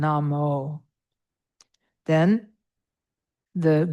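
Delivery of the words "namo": "Namo" with the O held long, NAH-mooh. Shown as a demonstration. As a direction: stretch the final O for the class. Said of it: "that long O sound".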